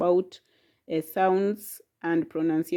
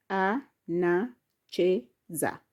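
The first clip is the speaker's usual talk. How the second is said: "A, na, che, za" softer, in even pieces; syllable by syllable; 4 semitones lower, 5 dB quieter than usual. Shown as a demonstration. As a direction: quietly tap out "A", "na", "che", "za". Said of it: "has syllable".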